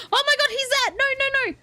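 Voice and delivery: high, loud burst of alarm; real high-pitched startled panic. Real, not acted. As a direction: cry out as you suddenly spot it. Robot suddenly reappears.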